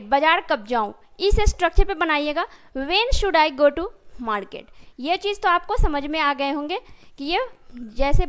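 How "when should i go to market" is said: very slow, word by word; slow, careful model sentence; slow dictation. Shown as a demonstration. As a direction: spell it out slowly for the class. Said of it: "teacher models the English sentence".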